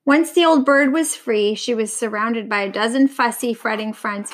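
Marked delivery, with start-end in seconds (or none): none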